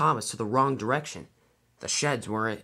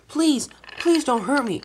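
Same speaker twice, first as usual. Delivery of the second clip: high, drawn-out begging; frightened pleading; 12 semitones higher, 4 dB louder than usual. A narrator's reading. Thomas begging Diesel not to capture him, frightened plea. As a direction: plead for mercy, pitched way up.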